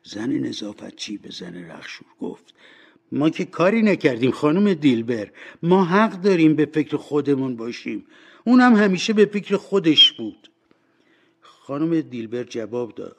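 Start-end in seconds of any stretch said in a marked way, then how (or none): 3.1-10.5 high, loud woman defending herself; self-justifying cleaning woman. Acted. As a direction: justify yourself loudly, pitch climbing.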